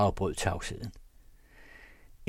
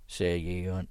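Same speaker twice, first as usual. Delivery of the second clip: ordinary delivery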